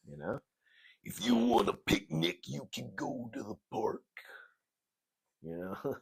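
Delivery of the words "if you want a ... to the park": raspy whispered wrestler growl, slow; Macho Man impression. Host imitating the Macho Man quoting Probst. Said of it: "hear the Macho Man saying that".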